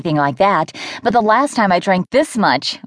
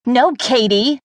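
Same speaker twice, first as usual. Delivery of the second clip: sharp, higher snap of the name; snapped; 5 semitones higher, slower than usual. A narrator's reading. Maddie turning on her best friend Katie, sharp snap. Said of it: "I snapped".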